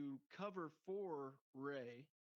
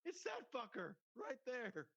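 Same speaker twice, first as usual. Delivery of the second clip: high put-on voice pointing someone out; squeaky tattletale voice; hammed-up accusing passenger; mock accuser; 9 semitones higher than usual. Imitating accuser on the train.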